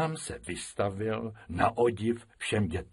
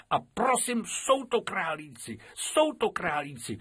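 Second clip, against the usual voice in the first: put-on little girl insisting; 8 semitones higher than usual.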